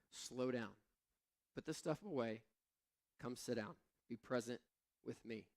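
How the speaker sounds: soft, tender calling back; gentle Jesus. Retold or imitated. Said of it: "Jesus was like".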